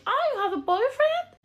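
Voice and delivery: put-on girlfriend's voice. The girlfriend asks about the boyfriend, high and slow mock voice. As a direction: mimic the girlfriend in a high, drawn-out English. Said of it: "il y a sa meuf qui réplique".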